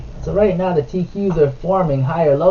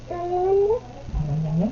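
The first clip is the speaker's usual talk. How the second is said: sing-song yum; 14 semitones higher, 7 dB quieter, much slower than usual. Real, not acted. Dad coaxing toddler to eat.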